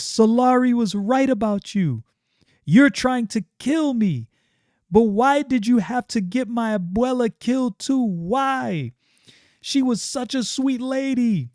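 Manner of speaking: high-pitched accusing cries; a grieving girl lashing out; hurt and angry. Acted. Stefania accuses Yaira over her grandmother, high and pained. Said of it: "She is pissed".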